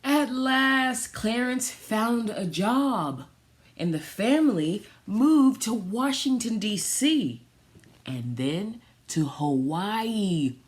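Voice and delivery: lively read-aloud, slowing on the key lines; storybook reading. Acted. Reading a family story aloud to listeners, storybook voice. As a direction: read it like a picture book for kids. Reads picture book aloud.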